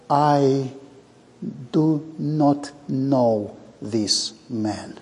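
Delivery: very slow, word-by-word denial; deliberate denial. Acted. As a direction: space out each word, slowly and firmly. Deliberate quotation of Peter's denial.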